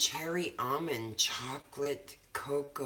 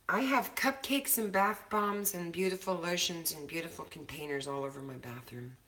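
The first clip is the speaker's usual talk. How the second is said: normal talking voice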